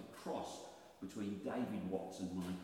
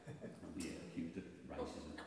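ordinary talk

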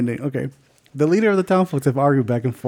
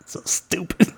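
whispered laugh; 5 semitones higher than usual. Real, not acted. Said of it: "laughing aside".

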